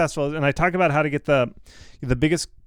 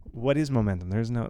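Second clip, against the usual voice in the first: ordinary talk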